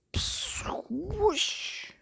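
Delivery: whispered rushing psst and whoosh; breathy whoosh sound effect. A narrator's reading. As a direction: blow the words out like a jet of water. Water jet sound effects.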